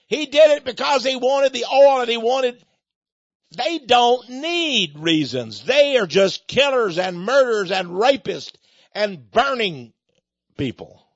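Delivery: loud, high angry tirade; real fury, slowing to hammer the words home; angry rant. Real, not acted. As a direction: rail against them at full heat. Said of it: "I'm sorry I'm ranting, but I, I'm just angry".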